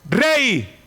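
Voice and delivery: loud, high-pitched hail across a distance; shouted call. Acted. David calling King Saúl from a distance, shouted. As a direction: call out to someone far ahead. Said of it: "y le grita".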